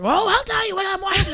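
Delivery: loud, high angry retort; angry shout. Retold or imitated. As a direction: snap back loudly, riled up. Husband's angry retort quoted.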